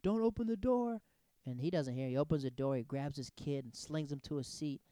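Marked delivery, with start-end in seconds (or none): 0.0-1.0 high, loud warning shout; imitated cop yell. Cop yelling at a kid in headphones, imitated shout. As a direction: call it out as a retold yell. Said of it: "you just hear the cop yelling".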